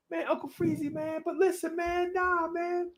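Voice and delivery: put-on high, soft, mumbled young guy's voice; Cully impression. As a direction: do Cully, way up and hushed, laid back. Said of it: "your impression of him".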